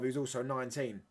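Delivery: ordinary talk, nothing marked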